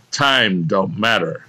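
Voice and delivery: ordinary delivery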